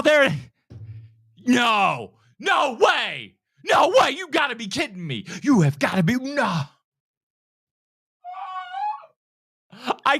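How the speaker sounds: high, over-the-top fake gasps and shouts building to a cheer; mock trailer reactor. Shown as a demonstration. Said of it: "They all follow this arc".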